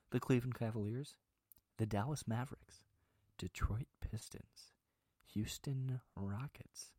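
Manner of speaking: soft, measured public-radio read with gentle pauses; NPR host voice. Pretend. Host reading the four-game teams to podcast listeners, NPR voice. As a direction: read it like a hushed, unhurried NPR announcer. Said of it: "I'll do the N P R".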